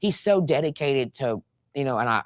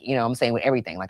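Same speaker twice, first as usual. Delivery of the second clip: usual speaking voice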